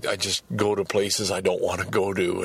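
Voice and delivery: ordinary talk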